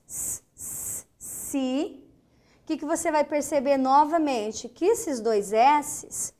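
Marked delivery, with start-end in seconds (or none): 0.1-1.1 S, S said as a long voiceless hiss; hissed S sound. Shown as a demonstration. Teacher showing the S sound to students. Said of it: "esse S".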